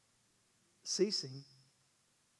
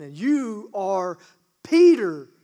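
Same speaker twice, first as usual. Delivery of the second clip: ringing proclamation; 13 dB louder, slower than usual. Acted. Jesus proclaiming Peter's new name, loud and slow. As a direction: proclaim it with full force.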